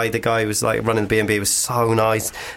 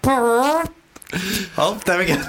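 mock relief; 8 semitones higher than usual. Acting relief at urinal.

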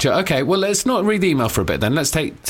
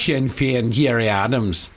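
lightly mimicked Northern Irish accent, lower; 4 semitones lower than usual. Cohost echoing friend's accent key phrase, mock Irish. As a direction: echo the phrase in put-on Irish. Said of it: "to get into an Irish accent".

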